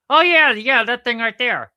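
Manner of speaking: high, loud puppet outburst; excited puppet. Acted. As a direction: jump in loudly with recognition. Puppet excitedly recognizes the rings.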